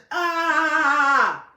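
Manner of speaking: long, loud, high "ah" held on one note; monotone yell. Shown as a demonstration. Showing how the brother said it, imitated monotone yell. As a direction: sustain an unwavering imitation yell. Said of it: "super monotone".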